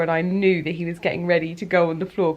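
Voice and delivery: usual speaking voice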